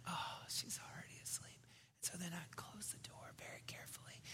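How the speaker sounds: barely-there whisper, careful not to wake her; hushed whisper. Retold or imitated. Dad closing his sleeping daughter's door, careful whisper. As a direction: whisper it as you tiptoe out. Retold creeping away from sleeping child.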